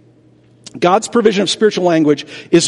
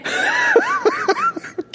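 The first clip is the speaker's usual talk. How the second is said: short laugh; 5 dB quieter than usual. Real, not acted. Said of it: "Besides being creeped out".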